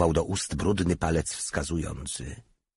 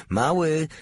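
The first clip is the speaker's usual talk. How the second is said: high, raised summons; commanding; 10 semitones higher, 4 dB louder than usual. A narrator's reading. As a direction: call the subordinate to order, pitched high. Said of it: "rozkazał Porta". (rozkazująco, wysokim głosem)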